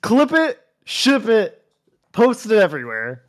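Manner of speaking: loud, gleeful and high; excited. Real, not acted. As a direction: hype it up gleefully. Gleefully urging to spread the clip.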